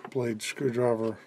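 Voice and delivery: ordinary delivery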